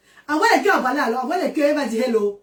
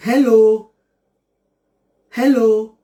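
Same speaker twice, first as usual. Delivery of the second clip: hello said louder, drawn out and clear; modeling hello; 6 dB louder, much slower than usual. Shown as a demonstration. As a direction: sound out hello for the boy to copy. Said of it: "Fais alors hello".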